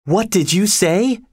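put-on high-pitched, shocked girl; shocked gasp. A narrator's reading. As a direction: ask it high, taken aback. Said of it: "gasped Kathy".

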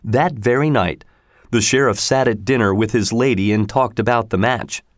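ordinary delivery, nothing marked

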